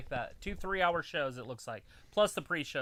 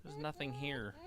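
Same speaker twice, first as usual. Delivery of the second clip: ordinary delivery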